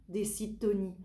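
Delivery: ordinary delivery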